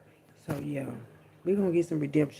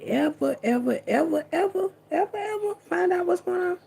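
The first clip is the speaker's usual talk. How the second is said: each ever climbs higher and higher; exasperated, climbing way up on ever; rising disbelief; 13 semitones higher than usual. Real, not acted. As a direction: stack the evers up into a high incredulous pitch.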